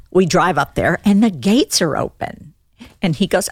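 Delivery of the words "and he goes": normal talking voice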